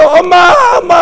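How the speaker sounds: high, shouted pleading cries; cling and cry mom through the scolding; child crying for mom. Retold or imitated. Child clinging to a scolding mother, shouting and crying.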